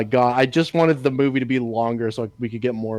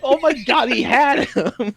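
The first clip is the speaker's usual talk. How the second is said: higher, incredulous exclamation; exasperated disbelief, pitched up; laughing mock outrage; 10 semitones higher than usual. Co-host reacting to the scene, laughing disbelief. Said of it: "It's like".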